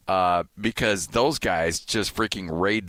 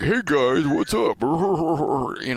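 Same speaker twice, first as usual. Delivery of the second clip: higher, obnoxious mock greeting ending in a goofy laugh; Dave Fox; 7 semitones higher, much slower than usual. Broadcaster mimicking the man raiding his booth's food, obnoxious. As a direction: imitate a loud, barging-in food raider. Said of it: "loud, obnoxious voice behind me".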